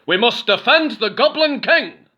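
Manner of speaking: high, loud rallying goblins; rally to the king; goblin chorus. A narrator's reading. Goblins rallying, high loud chorus. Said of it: "said the other goblins".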